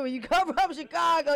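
ordinary talk, nothing marked

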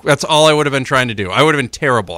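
usual speaking voice

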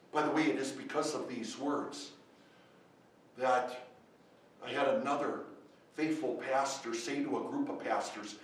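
normal talking voice

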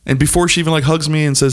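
ordinary delivery

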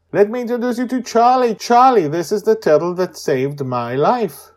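put-on high, loud child's voice; Joy's voice. Joy telling the tortoise its new home, high and eager. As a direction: speak like an eager girl.

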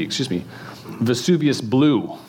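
ordinary delivery

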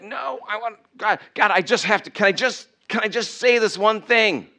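high-pitched begging to speak; desperate pleading. Acted. Mocked believer begging God to let them answer back, desperate plea. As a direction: plead urgently, pitched well up. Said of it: "you so badly want to be like".

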